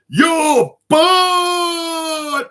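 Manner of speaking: very high, loud, long-drawn yell; imitated full-force shout. Imitating the woman at the Baker's shouting at him, mock yell. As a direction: holler it high and stretch the last word. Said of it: "shouted at me as loud as she could".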